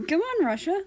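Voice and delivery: high, loud, bright call to a friend; eager call. Acted. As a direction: pull him along with your voice. America calls Russia to class.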